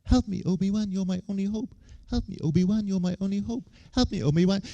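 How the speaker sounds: high, repeated plea, like a looping message; mock princess plea.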